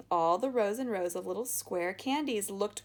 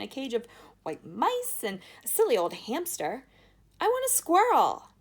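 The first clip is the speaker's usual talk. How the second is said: loud, high spoilt whine; whiny tantrum; 6 semitones higher, 6 dB louder than usual. A narrator's reading. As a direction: throw a tantrum.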